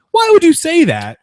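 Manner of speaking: can't believe anyone said that; incredulous outburst. Real, not acted. Host reacting to the reporter's remark with stunned disbelief.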